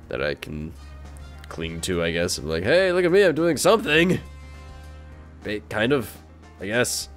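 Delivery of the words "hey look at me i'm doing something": higher, louder mock show-off; mock attention-seeking. YouTuber making fun of own daily uploads, mock boastful. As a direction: call out for attention, pitched up, self-mocking.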